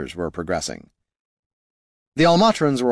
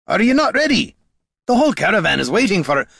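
loud, high-pitched outburst; exasperated scolding; 11 semitones higher, 5 dB louder than usual. A narrator's reading. Dwarf scolding the ranger as the caravan waits, exasperated. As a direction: scold in disbelief, voice raised.

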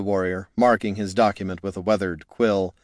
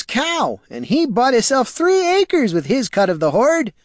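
put-on rustic old man, aggrieved and earnest; high, pleading old farmer; 12 semitones higher, 3 dB louder than usual. Farmer telling the warrior how he hoped to get rich, aggrieved. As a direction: lay out his lost hopes in a high voice. Farmer explains his hopes.

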